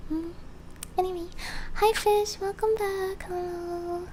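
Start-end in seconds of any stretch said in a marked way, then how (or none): none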